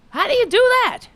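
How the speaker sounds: high, loud, stunned disbelief; amazed. Real, not acted. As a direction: shout it up high in awe. Amazed at author writing many books.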